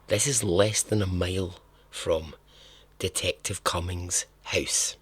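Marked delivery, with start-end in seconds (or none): none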